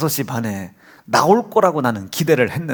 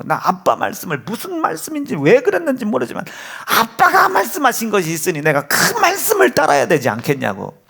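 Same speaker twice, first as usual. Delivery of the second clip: high earnest pleading son; 9 semitones higher than usual. Pretend. Imagined son begging pastors to let him worship, high pleading. Voicing ideal obedient son's plea.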